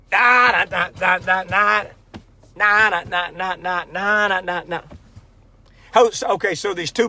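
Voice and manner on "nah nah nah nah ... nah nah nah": sing-song vocal sting after a joke; mock punchline sting. Comedian covering for a failed laugh track, mock sting.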